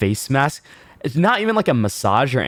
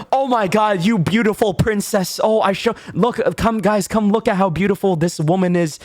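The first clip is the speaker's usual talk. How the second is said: fawning staff; 9 semitones higher than usual. Retold or imitated. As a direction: overdo the sucking-up to the pretty customer. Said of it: "I was like".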